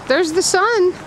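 high, loud excited cry; thrilled the sun is out. Real, not acted. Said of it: "but there's the sun".

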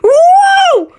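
very high, loud whoop; excited whoop. Real, not acted. Player whooping while lifted into space. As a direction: whoop as you fly upward.

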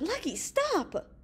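high, drawn-out, urgent cry; alarmed high-pitched yell. A narrator's reading. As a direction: call out desperately to halt him, slowly stretched. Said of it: "Bigman cried".

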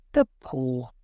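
sudden, urgent exclamation; crying out. A narrator's reading. Wife suddenly remembering the paw, crying out. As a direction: cry it out, startled. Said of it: "she cried".

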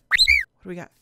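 very high excited question; high-pitched curious squeal; eager squeak. Real, not acted. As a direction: squeak with anticipation. Checking the new baby.

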